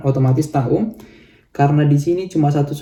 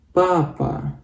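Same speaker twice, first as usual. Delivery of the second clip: "papa" with the first "pa" clearly higher; stress on first syllable; 4 semitones higher, 5 dB quieter than usual. Shown as a demonstration. Teacher demonstrating Russian stress to learners, first syllable raised. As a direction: rise on the first "pa", drop on the second. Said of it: "maka jadi".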